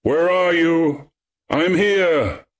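high, drawn-out distant call; hallooing. A narrator's reading. Young man calling to his strayed companions, hallooing. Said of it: "He hallooed them".

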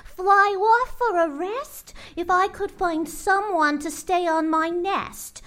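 high, lazy little bird voice, slow at the end; a pampered bird sighing for a break; lazy songbird voice; Maisie the bird. Acted.

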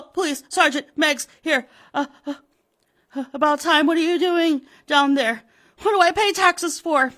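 high, loud stammering panic; panicked call. Acted. [name] panicking on the phone to the police.

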